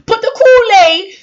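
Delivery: high, loud preaching cry; shouting prayer at full pitch; fervent shout. Acted. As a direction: cry it out to the Lord. Peak of the prayer.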